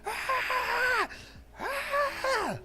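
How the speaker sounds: very high mock cry of pain; mock pained yell. Narrator imitating his high brother yelling in pain. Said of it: "starts yelling in the car".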